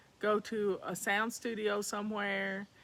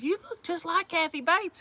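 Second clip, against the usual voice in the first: higher, brightly mimicking a stranger lady; lightly voicing the lady's comment; stranger's remark; 7 semitones higher, 4 dB louder than usual. Lady outside the theater compares her to Kathy Bates, mimicking her higher.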